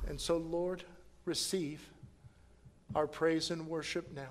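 soft, low, earnest praying; quiet prayer. Acted. Pastor leading the church in closing prayer, soft and low. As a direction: pray it gently to close.

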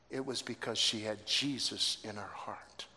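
ordinary talk

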